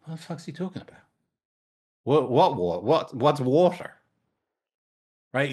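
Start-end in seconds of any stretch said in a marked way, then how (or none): 0.0-3.9 confused fish, a bit higher; puzzled what-what stammer; lightly voiced baffled fish. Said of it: "the two fish say to each other".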